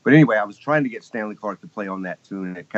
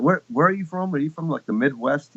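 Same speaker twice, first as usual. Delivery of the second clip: puzzled questioning; 6 semitones higher than usual. Retold or imitated. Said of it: "people said".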